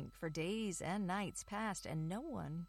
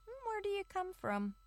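very high curious little mouse; 13 semitones higher than usual. Acted. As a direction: ask eagerly like a tiny mouse. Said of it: "asked the mice".

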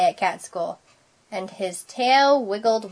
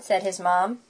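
ordinary delivery

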